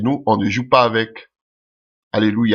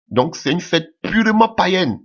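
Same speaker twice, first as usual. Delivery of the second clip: fervent preaching; 8 semitones higher than usual. Real, not acted. Preacher denouncing pagan feast.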